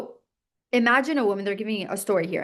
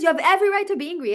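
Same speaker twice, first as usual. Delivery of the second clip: higher, quick, egging-on inner voice; coaxing inner voice; 7 semitones higher, much faster than usual. Retold or imitated. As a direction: rattle it off like a voice goading you to anger. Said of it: "He says".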